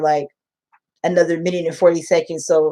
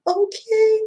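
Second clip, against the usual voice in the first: put-on a tiny, much higher "okay" from a child near tears; small tearful okay. A crying student answering her teacher with a small tearful okay. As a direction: voice a little girl holding back tears. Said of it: "I could tell she was crying".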